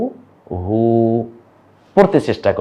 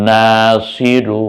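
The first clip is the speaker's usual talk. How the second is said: slow drawn-out reading; much slower than usual. Shown as a demonstration. Teacher to class, reading the new words aloud, very slow. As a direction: read every word slowly and clearly. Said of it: "এখন আরবিগুলো দেখুন".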